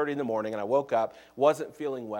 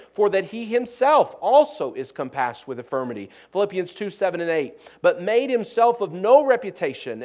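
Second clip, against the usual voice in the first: raised, louder pulpit reading voice; scripture reading; 5 semitones higher, 6 dB louder than usual. Acted. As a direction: proclaim the verses to the congregation, ringing out. Reads scripture at full preaching force.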